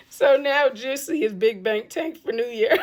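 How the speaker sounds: talking through a real laugh; laughing in disbelief. Real, not acted. Host joking about the hacker's haul, laughing in disbelief. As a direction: let the laugh spill out at the end. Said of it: "I'm not laughing because I'm happy".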